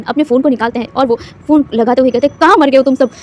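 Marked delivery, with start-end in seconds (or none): 2.4-3.1 loud, higher furious yell down the phone; angry shout. A narrator's reading. Father yelling at helpers on the phone, furious. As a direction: bellow at them to hurry. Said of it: "वो चिल्लाने लग गया था".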